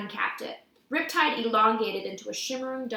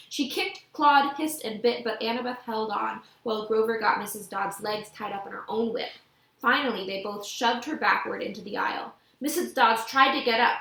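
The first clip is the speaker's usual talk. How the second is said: ordinary delivery, nothing marked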